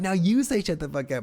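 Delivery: retold onstage holler; mock yelled command. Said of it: "screaming at these people".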